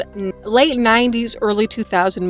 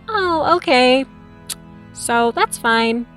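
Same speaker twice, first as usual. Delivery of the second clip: deadpan shrug; 3 semitones higher, much slower than usual. Retold or imitated. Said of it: "they're like".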